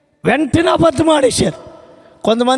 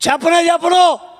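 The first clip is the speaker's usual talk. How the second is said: defiant shout; 4 semitones higher than usual. Retold or imitated.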